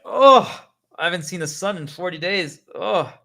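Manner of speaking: high, loud wonder at the sun; amazed gasp. Pretend. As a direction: gasp like seeing daylight first time. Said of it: "we're like".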